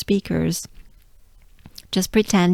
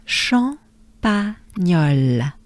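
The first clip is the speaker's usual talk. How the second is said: Champagnole drawn out slowly again, each syllable held; slow repeat; much slower than usual. Shown as a demonstration. Teacher repeating Champagnole slowly with the class. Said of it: "Good breath with me".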